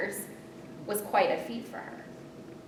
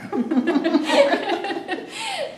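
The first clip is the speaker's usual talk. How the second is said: high burst of laughter; big laugh; 12 semitones higher, 8 dB louder than usual. Real, not acted. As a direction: laugh out loud. Laughs at own panic.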